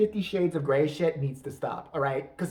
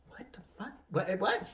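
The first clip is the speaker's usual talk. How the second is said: whispered, stunned reaction; mock bewildered partner; 5 dB quieter than usual. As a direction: whisper it in baffled disbelief. Partner reacts to cannibal talk.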